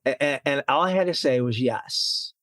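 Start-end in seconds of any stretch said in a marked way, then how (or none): none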